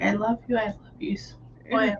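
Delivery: soft, unvoiced whisper of love; tender whisper to a son. Mother whispering to her sweet boy, tenderly. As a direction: breathe it gently like soothing a child. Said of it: "if I had my son and I was like".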